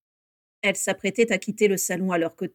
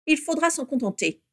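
higher-pitched curt reply; dry, curt; 7 semitones higher than usual. A narrator's reading. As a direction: close the matter drily. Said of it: "répondit sèchement Abraxas Malfoy". (réponse sèche)

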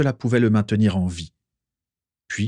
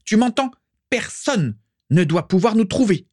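a proud mother, urgent and insistent, much higher; 8 semitones higher than usual. A narrator's reading. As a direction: press him hard, fear under command. Mother urges husband to protect house.

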